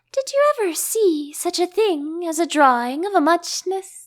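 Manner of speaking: high, drifting dormouse chatter; a half-asleep dormouse rambling; dormouse voice, just woken. A narrator's reading. Pinched awake dormouse rambles on to Alice, high and drowsy.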